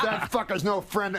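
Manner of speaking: high, mock-indignant outburst; indignant Rodney. Old comic in elevator rejects common friend, indignant. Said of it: "He goes".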